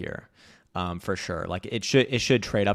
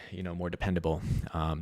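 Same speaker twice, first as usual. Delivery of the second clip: ordinary delivery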